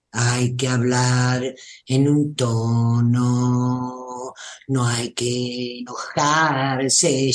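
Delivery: slow, low, drowsy monotone demo; sleepy monotone. Shown as a demonstration. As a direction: drag it out half asleep. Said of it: "de hacer el ejemplo me dormí". (voz monótona, lenta y grave, casi dormida)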